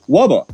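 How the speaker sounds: "Wubba" with w for r; childlike "Wubba" for rubber; says w for r. Retold or imitated. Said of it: "And you're like".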